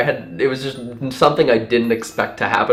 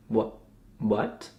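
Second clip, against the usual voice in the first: tiny, quiet, halting question; dumbfounded, hardly any voice; acted speechless disbelief; stunned; 2 semitones lower, 11 dB quieter than usual. Stunned reply to her question.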